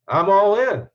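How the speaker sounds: eager. Retold or imitated. Telling the major he signs up, eager and loud. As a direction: commit with a burst of eagerness.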